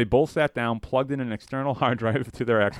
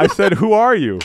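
raised, insistent question; louder insistent repeat; 8 semitones higher, 8 dB louder than usual. Acted. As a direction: demand it again, louder.

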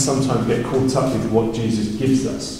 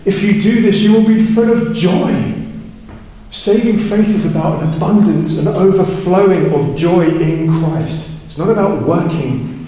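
joyful climax; 5 semitones higher than usual. Real, not acted. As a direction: lift it up with joy. Preacher rising to joyful climax.

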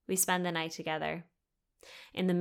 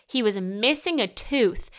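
louder, emphatic outraged statement; loud disbelief; 3 semitones higher, 6 dB louder than usual. Real, not acted. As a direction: hit MISSING and TOOTH with loud incredulity.

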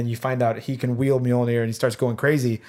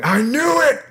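high, louder gleeful cry in Thor's voice; imitating Thor; 11 semitones higher, 4 dB louder, slower than usual. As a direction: shout it like a vindicated god. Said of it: "Thor going".

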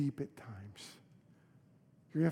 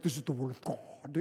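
a light try at a booming preacher; lowered, slightly softer pulpit voice; a hint of a deep-voiced pastor; 6 dB quieter than usual. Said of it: "those deep voices that can".

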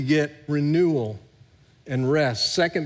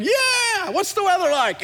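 much higher, squealing mock delight; giddy excitement. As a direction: gush like a thrilled child. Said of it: "And I'm like".

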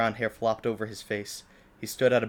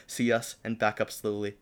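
ordinary talk

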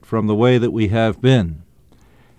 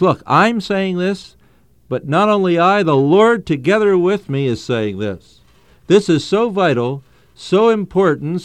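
higher, insistent delivery voicing Paul's plea; emphatic; 5 semitones higher than usual. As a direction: drive each point home as Paul, pitch raised. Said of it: "in order to emphasize what he has to say".

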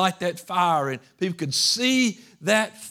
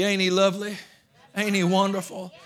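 higher, louder Georgia Southern twang; Georgia Southern accent; 4 semitones higher, 5 dB louder than usual. Shown as a demonstration. Preacher praising Jesus his own way, Georgia Southern accent. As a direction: belt it out in good old Georgia Southern. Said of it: "Georgia Southern accent".

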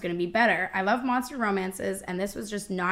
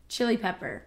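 ordinary delivery

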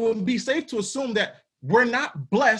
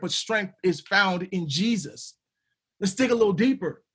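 ordinary delivery

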